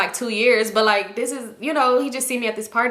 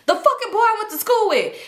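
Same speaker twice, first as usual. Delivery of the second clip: exasperated reveal; 11 semitones higher than usual. Real, not acted. Revealing to viewers who was staring, high-pitched disbelief.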